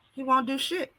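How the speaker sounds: ordinary delivery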